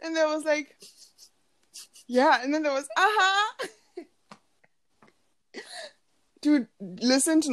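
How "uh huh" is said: high mimicked "uh-huh"; imitated pop interjection; hyping ad-lib. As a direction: chirp it like a song ad-lib. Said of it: "hyping us up".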